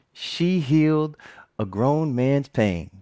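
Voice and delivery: slowed, heartfelt; slow sincere emphasis; slow and weighty. Real, not acted. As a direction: let each word land slowly. Emotional climax, slowed.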